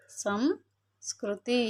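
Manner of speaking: సంస్కృతి stretched out very slowly, syllable by syllable; slow demo pronunciation. Shown as a demonstration. Teacher showing children how to pronounce, very slow. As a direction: draw out సంస్కృతి slowly so each sound is heard. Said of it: "పలికేటప్పుడు సంస్కృతి".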